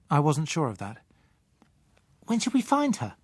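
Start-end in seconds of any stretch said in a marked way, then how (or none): 2.3-3.2 put-on high child voice, curious; a child asking on the road; curious little girl. Child Susan voiced in story.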